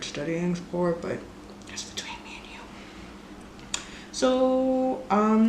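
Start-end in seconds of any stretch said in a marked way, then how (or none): 1.7-3.8 whisper. Real, not acted. Secret aside about not studying.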